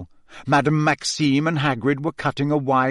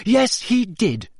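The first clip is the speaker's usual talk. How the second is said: high, slow, emphatic retort; stung and emphatic; defiant admission; 9 semitones higher, slower than usual. A narrator's reading. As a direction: admit it defiantly, pitched up. Acted quarrel, defiant admission.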